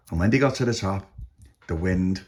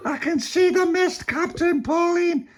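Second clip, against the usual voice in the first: very high, drawn-out call; slower than usual. A narrator's reading. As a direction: call down from the masthead. Lookout calls down from mast.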